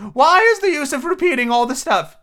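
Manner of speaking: high, loud plaintive turtle; a high wailing creature voice; Mock Turtle voice.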